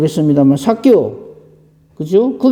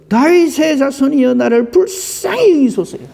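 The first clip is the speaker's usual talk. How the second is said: retold louder cry; 8 semitones higher than usual. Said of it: "더욱 크게 소리 질러".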